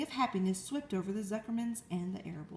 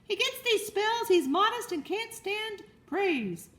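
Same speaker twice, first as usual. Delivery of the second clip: higher, louder, slow flustered excuse; flustered cry; 11 semitones higher, 5 dB louder, slower than usual. Acted. Farmer covering for fainted pig, higher flustered call. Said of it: "cried Mister Zuckerman".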